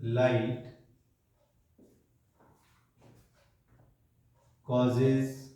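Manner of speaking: a word or two, then a long wait; unhurried, broken-up classroom dictation; slow dictation. Real, not acted. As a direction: dictate it piece by piece for note-takers. Dictating points for children to write.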